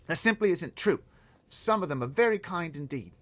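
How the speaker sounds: put-on a small girl pleading to stop the giants; high girl's voice. Girl begging the giant, high and loud.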